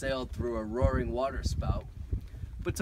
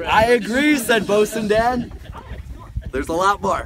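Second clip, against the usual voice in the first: loud sailor voice; 8 semitones higher, 13 dB louder than usual. Acted. Voicing Bosun Dan.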